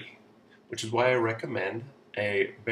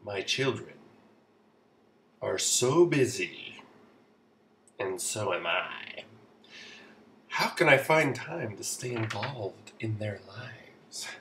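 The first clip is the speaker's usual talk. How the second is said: mock weary parent. Acting out a weary busy parent's question.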